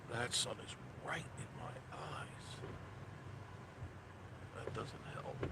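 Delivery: whispered complaint; whispering. Real, not acted. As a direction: mutter it under the breath. Squinting into sun, muttering.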